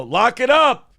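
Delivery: sharp shouted command; shouted order. Retold or imitated. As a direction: yell it down the hole. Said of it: "and they go".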